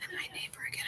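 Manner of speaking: unvoiced whisper; confiding whisper. Real, not acted. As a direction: hush it. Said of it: "whispered aside".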